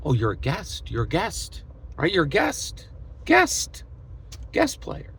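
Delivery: mock the empty promise; mocking singsong. Vlogger mocking the hollow guest promise to Robbie, sarcastic singsong.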